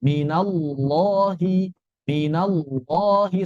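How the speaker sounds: 'minallahi' slow, with a heavy back 'loh'; thick lam. Shown as a demonstration. Teacher shows lam after fathah, thick. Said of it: "bedanya dia dibaca tebal".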